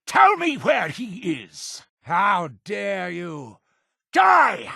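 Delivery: high shout building to an attack cry; demand, take offence, then scream for blood; furious demand. Acted. Interrogator turning on a suspected enemy, furious shouting.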